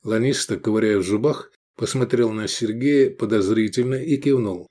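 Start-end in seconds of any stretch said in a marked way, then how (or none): none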